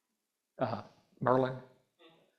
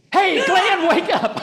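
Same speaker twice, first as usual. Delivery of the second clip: loud wake-up shout; 16 dB louder, slower than usual. Shown as a demonstration. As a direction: shout it high and loud to jolt him awake. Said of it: "You can go".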